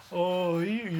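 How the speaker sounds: mock-cute plea for affection; little voice. Shown as a demonstration. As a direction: coax it out in a pleading tone. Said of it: "allá vas con vocecita así".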